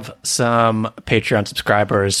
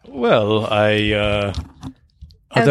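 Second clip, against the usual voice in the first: hammed-up British accent, hesitant at the end; put-on British accent; slower than usual. Host interviewing himself as his other self, mock British accent. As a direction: play a bad British interviewer and guest. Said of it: "other [name] is British, apparently".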